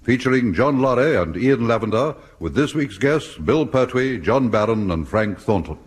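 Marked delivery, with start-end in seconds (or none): none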